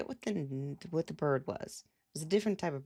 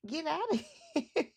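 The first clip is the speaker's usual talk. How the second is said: much higher, fast, squeaky bird ranting; squeaky angry bird; faster than usual. Retold or imitated. A bird telling her to get out, squeaky and fast. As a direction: voice the bird as a tiny furious critter. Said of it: "It's like".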